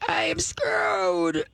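very high, slow, whimpering puppy voice; the dog realizing it's in trouble; dog's scared voice. Retold or imitated. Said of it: "And the dog is like".

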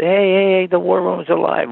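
high, loud retold hail to friends; excited calling out. Calling his buddies about the old war room, excited shout. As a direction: shout it out like great news.

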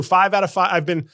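ordinary talk, nothing marked

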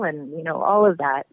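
normal talking voice

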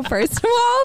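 ordinary talk, nothing marked

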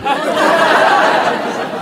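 breathy high laugh. Real, not acted. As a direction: burst out laughing. Laughs about his wife.

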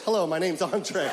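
Andre. Retold or imitated. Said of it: "he talks like this".

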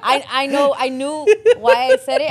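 loud, high, laughing protest; laughing admission. Real, not acted. Woman teased for probing by co-hosts, laughing admission. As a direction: own up through real laughter.

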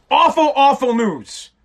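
mock newsman with awful news; mock grave news. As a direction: ham up the bad news.

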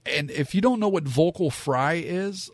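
ordinary talk, nothing marked

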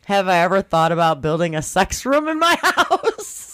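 real giggles breaking into the question; laughing while reading. Real, not acted. Reading a listener question about a sex room, laughing. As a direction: read it out while cracking up. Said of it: "you'll see why I'm laughing".

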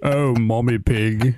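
slow hammed-up groan of the name; mock Daddy Pig moan. Host voicing Daddy Pig in the joke, slow groan. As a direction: drag it out like a lovesick pig. Acting Peppa Pig bedroom scene.

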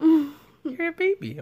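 high, slow sing-song tease; teasing baby voice. Acted. Mocking partner's cute voice.

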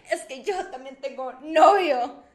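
slow, loud mock confession; dramatic confession. Sister confesses to dad to share scolding, dramatic. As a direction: draw out the big reveal melodramatically. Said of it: "así súper dramática".

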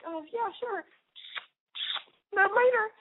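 high-pitched, evasive mock reply; hammed-up high, awkward deflection; flustered brush-off. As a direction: act out dodging a goodbye. Retold hasty goodbye while fleeing.

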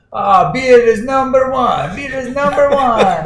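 put-on high, heavy Russian accent; cheerful high-pitched Russian accent impression. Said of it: "heavy Russian accent says".

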